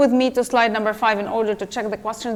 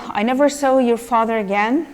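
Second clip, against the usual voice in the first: usual speaking voice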